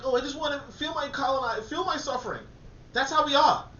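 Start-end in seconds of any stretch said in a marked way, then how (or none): 0.0-2.4 high, mocking whine; lightly hammed-up sneering voice; mock colonizer voice. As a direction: mimic a smug colonizer, pitched up. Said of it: "he's like".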